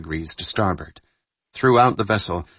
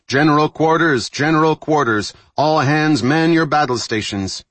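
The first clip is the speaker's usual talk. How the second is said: higher, louder shipboard announcement; shipboard alarm call; 8 semitones higher, 6 dB louder than usual. Acted. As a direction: call all hands to stations. Alarm announcement over the 1MC.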